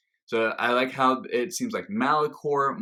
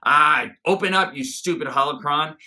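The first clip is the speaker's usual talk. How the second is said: loud, higher-pitched mock exasperation; Maul, frustrated; 6 semitones higher, 7 dB louder than usual. Reviewer imitating Maul fighting with the holocron, loud frustrated outburst. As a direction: imitate someone fed up with a stuck gadget, bursting out. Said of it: "see Maul being frustrated".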